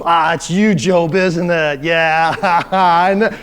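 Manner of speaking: loud, laughing teasing accusation; God jokingly blaming Job for the lightning; mock accusing. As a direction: pin it on Job with a chuckle. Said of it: "The sarcasm is just what gets me".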